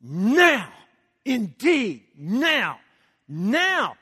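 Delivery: each NOW swells and falls; emphatic repeated NOW. Acted. Preacher repeating Paul's word to the congregation, emphatic on NOW. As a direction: hammer every NOW as its own declaration. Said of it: "you hear the emphasis?".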